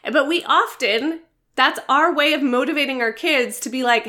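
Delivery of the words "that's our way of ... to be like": ordinary talk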